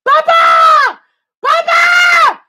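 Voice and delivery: very high, loud wailing; child's scream. Retold or imitated. Child being beaten screaming for his father. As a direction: shriek for your dad in panic.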